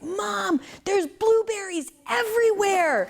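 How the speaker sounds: put-on high, bright kid's voice calling out; excited little boy. Boy running to his mom on the farm, high excited kid voice. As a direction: play the four-year-old thrilled by a discovery. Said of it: "and he's like".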